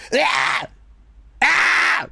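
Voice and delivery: loud, high bursts of yelling; re-enacted scream takes. Voice actor imitating himself in a long recording session, screaming. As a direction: scream it out, one take after another. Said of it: "twenty minutes just going".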